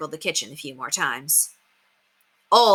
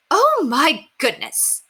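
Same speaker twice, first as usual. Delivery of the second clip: high, slow, drawn-out gasp of dismay; a mother finding her dripping kitchen; shocked exclamation; 9 semitones higher, 4 dB louder, much slower than usual. Acted. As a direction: take in the disaster with horror. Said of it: "exclaimed Missus Kelly".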